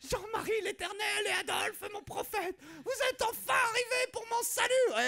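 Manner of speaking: very high, crying, shouting prayer; hammed-up mystic rapture; religious ecstasy. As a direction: wail like a woman seeing saints. Ecstatic vision of the Virgin.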